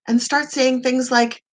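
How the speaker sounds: normal talking voice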